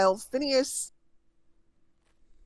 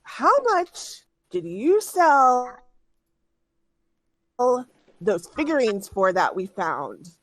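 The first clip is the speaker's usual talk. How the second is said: slow, drawn-out demand, pitched higher; bandit leader demanding; 4 semitones higher, slower than usual. Acted. Bandit leader Leandra grilling Zayden, slow and demanding. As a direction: bandit boss grilling her underling slowly.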